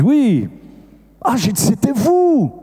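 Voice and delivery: high, swooping exclamation; astonished recognition. Retold or imitated. Priest recognizing the woman a month later, astonished. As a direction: exclaim in sudden recognition, pitch leaping up.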